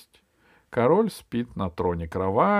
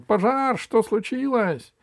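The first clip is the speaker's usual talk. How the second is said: high, stretched alarm call; panicked king jolted awake; startled cry; 13 semitones higher, 4 dB louder than usual. Acted. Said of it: "закричал он". (испуганный крик)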